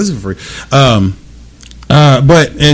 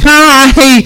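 high, loud, drawn-out hello; eager greeting; 13 semitones higher, 7 dB louder than usual. Retold or imitated. Man imagining greeting a pretty stranger, high and loud. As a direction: call out a hammed-up, high greeting.

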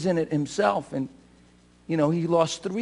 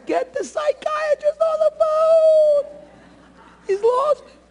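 high, slow, hammed-up panicked wife; mock alarmed wife; slower than usual. Wife calls for a psychiatrist, high mock-panicked voice. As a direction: squeal it like a startled wife. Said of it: "and my wife's".

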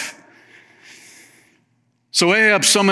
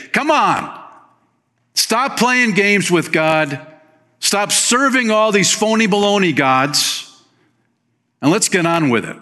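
a hint of a pleading prophet, pitched higher; prophet's plea; 8 semitones higher than usual. Preacher voicing Elijah calling Israel back, lightly voiced higher plea. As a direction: urge them to stop playing games, pitched up. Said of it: "to be that voice really in the wilderness to say".

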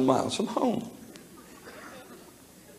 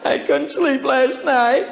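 voicing the Australian; 9 semitones higher, 5 dB louder, slower than usual. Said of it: "He said".

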